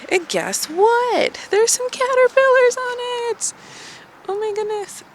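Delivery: high, thrilled squeal of discovery; excited. Real, not acted. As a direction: gush with delight, high.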